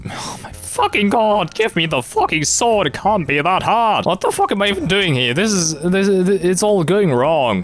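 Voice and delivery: high, frantic frustration; exasperated outburst. Real, not acted. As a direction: break off into a high-pitched rant at the game. Losing patience with the game.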